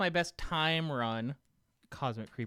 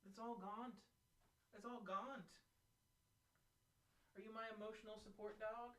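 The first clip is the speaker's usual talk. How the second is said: higher, soft pet-talk voice; cooing to the dog; 6 semitones higher, 19 dB quieter than usual. Real, not acted. Streamer cooing at his emotional support dog, higher and soft.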